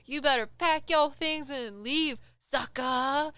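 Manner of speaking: mom's loud, high telling-off, acted out; imitated yelling. Mom yells at a ghost over speakerphone, imitated yelling. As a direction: yell at an intruder like a protective mother. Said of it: "she starts just yelling at this ghost".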